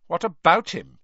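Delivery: hard dismissive reply. A narrator's reading. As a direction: shut him down coldly. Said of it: "in a hard voice".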